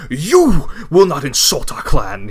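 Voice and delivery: slow, loud furious shout; angry shout. Acted. Ishmael slams his glass and shouts at Takshan, furious. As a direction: roar it at him, fired up. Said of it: "he's getting real fired up".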